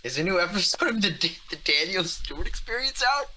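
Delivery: high, eager imitation of a friend; mock Jake voice. Teasing a friend hearing a new episode, high eager mock voice. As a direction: play the excited fan in his car. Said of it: "And Jake goes".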